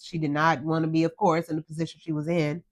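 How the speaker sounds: ordinary talk, nothing marked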